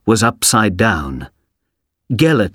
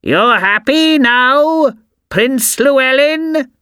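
shrieking, much higher, slow witch's taunt; screaming witch; slower than usual. A narrator's reading. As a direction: screech it after him like a witch. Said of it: "screamed the old woman".

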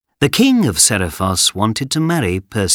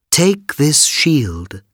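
slow, higher, stately goddess voice; solemn goddess; 6 semitones higher, much slower than usual. A narrator's reading. Goddess Athena gives the hero a shield, stately. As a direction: bestow it grandly, drawing out each word.

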